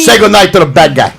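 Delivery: mock Cuban gangster accent; Tony Montana. Taunting as the bad guy, mock Cuban gangster voice. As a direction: play a Cuban crime boss, hammed-up. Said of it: "let's do Tony Montana".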